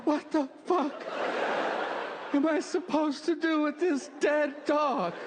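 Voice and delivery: put-on high-pitched bewildered kid voice; appalled little son. Voices his appalled young son.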